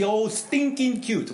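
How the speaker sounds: imitated German-accented English, high and drawn out. Uncle imitating his little niece in Switzerland, German accent. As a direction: put on a niece's German accent, stretching it slowly. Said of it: "say that with a German accent".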